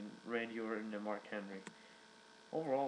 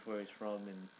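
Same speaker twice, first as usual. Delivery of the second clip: normal talking voice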